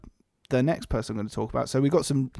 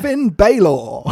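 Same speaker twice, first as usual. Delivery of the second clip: slow, drawn-out William Regal-style name call; louder, slow William Regal impression; 6 semitones higher, 7 dB louder, much slower than usual. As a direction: stretch the name like a grand old announcer. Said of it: "William Regal".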